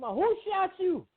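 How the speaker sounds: high, slow mock mother demanding an answer; mama asking alarmed. Mother confronts son in story.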